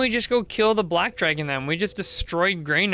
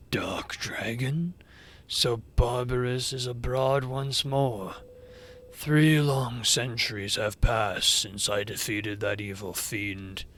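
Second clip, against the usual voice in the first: low, slow, softened dragon voice; brooding old dragon; 6 semitones lower, 3 dB quieter, slower than usual. Acted. Old dragon recalls a defeated fiend, low and brooding. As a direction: weigh each word with ancient gravity.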